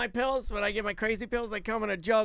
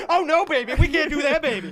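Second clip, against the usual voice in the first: higher, louder put-on motherly receptionist; 7 semitones higher, 6 dB louder than usual. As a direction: play the receptionist turning him down, higher and louder. Voicing the doctor's receptionist.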